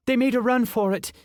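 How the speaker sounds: Ashley breaking bad news; young woman voice.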